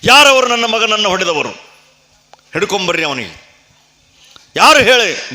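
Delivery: high, loud angry questions; furious demand. Acted. Grieving king angrily ordering the killer be seized. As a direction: rage at whoever did it.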